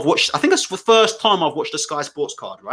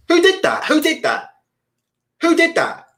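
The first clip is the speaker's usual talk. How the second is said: incredulous; 9 semitones higher than usual. Real, not acted. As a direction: demand an answer in disbelief. Incredulous rant about presenter choice.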